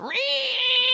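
long, very high door squeal; drawn-out imitated door creak; creaky door imitation. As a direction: squeal like an unoiled hinge. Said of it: "I forgot her door goes".

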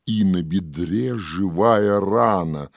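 usual speaking voice

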